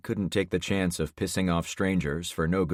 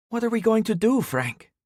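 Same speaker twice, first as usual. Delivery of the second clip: much higher, urgent worried question; anxious Carmen; 4 dB louder than usual. A narrator's reading. Carmen nudges the driver, asking what to do, high and anxious. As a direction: press him for an answer, pitched high.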